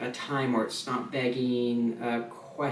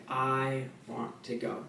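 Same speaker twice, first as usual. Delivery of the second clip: slow, soft I want to go; slow lean back; 6 dB quieter, slower than usual. Shown as a demonstration. Teacher demonstrating the comment part slowly for beginners. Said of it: "lean back when you say".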